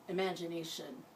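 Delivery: usual speaking voice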